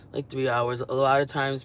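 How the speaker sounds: ordinary talk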